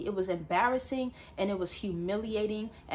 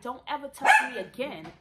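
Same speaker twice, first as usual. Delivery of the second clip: raised, higher mock yell; firm retold warning, pitched up and louder; retold scream; 5 semitones higher, 8 dB louder than usual. Woman retelling warning to her groper, mock yell. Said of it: "I'm screaming".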